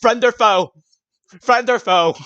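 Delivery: high loud guard cry; hammed-up sentry call; mock challenge. Game master shouting a mock sentry challenge. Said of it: "mock challenge".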